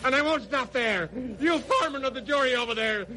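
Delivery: rising shouted threats of revenge; vengeful raving. Acted. Condemned killer raves at the jury, shouting. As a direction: rant at the whole court, building.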